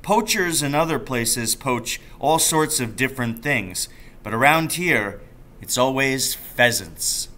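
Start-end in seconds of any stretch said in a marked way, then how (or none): none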